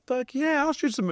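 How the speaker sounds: high-pitched burst of eagerness; eager yes. Retold or imitated. Filmmaker accepting a college's shoot offer, eager yes.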